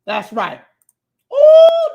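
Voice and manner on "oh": a sudden high, loud, delighted "oh". Real, not acted. Host spotting a viewer's super chat, thrilled high exclamation. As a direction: burst out with real delight.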